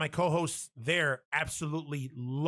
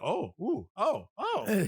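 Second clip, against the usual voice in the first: imitated rising excited oohs; mock super excited; 4 semitones higher, much slower than usual. Retelling the doll getting worked up, mock excited. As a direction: act out growing excitement, pitch jumping. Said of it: "he gets fucking super excited".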